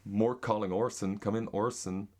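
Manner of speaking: American accent with sounded r's. Shown as a demonstration. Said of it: "Here's American".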